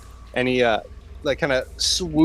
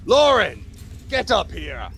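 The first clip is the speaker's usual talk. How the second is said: calling out; 8 semitones higher than usual. Acted. Hero summons halfling helper, calling out. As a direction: call a helper up from a distance.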